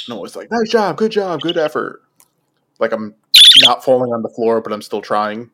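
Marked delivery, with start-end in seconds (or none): 0.5-2.0 higher-pitched encouraging cheer; upbeat sing-out praise, pitched up; cheering teammates on. Retold or imitated. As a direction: re-enact cheering from the court. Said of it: "I'm just cheering for everything".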